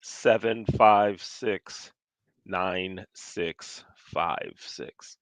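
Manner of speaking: slowly reads out a phone number, digit group by digit group; eyes closed, recites each number slowly. Real, not acted. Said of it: "I'm gonna close my eyes when I say it".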